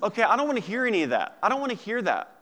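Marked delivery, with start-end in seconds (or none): none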